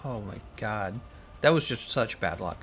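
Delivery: ordinary talk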